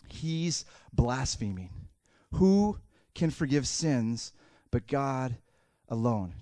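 very slow, weighted words; slow, deliberate indictment; grave accusation. Acted. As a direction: spell out the charge with long pauses. Religious leaders' grave thought.

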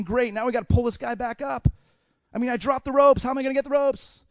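high, loud griping; exasperated friend. Acted. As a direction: complain as if stuck with a new problem. Acted roof friends' complaint.

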